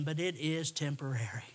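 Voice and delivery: soft whisper then a little laugh. Real, not acted. Preacher comforting the grieving, whispered with a chuckle. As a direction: let the comfort fall to a hushed whisper.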